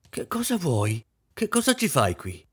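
high, sharp irritated outburst; mother snapping at her daughter, irritated; irritated exclamation. Acted. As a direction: snap at the girl, irritated. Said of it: "esclamò irritata".

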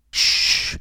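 whispery hissed shush; a long breath of shh; hushing shh. A narrator's reading. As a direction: hush the gossip quickly. Hushing as the sisters arrive.

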